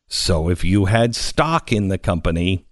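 ordinary talk, nothing marked

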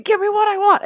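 high, loud wrestler yell; Dave Batista. Retold or imitated. Imitating the wrestler she pictures, yelled. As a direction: yell it like an enraged wrestler demanding his due. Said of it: "All I can see is Batista saying".

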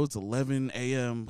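ordinary delivery

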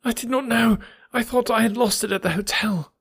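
high-pitched, anguished confession; groaning. A narrator's reading. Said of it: "he groaned".

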